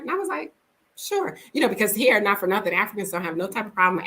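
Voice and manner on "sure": mock innocent sure. Her own retold answer.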